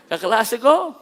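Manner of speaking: slow words said through a laugh. Real, not acted. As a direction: laugh it out, amused. Laughing about the classmate. (tumatawang sinasabi)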